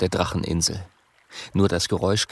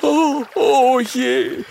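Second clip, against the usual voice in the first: high, loud, slow hammed-up despair; despairing sigh; 4 dB louder, slower than usual. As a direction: wail in mock despair. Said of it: "mit einem verzweifelten Seufzer". (verzweifelt seufzend)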